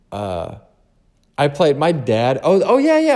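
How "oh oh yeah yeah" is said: sudden recall. Real, not acted. Suddenly remembers.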